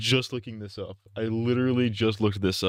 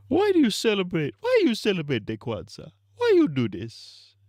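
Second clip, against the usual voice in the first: mock Nigerian accent; 9 semitones higher than usual. Streamer's mock Nigerian accent questioning Kwanzaa, very high. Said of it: "the Nigerian impression".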